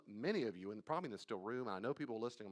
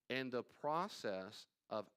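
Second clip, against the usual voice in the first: ordinary talk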